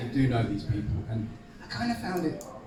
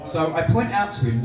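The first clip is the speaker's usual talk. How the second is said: ordinary delivery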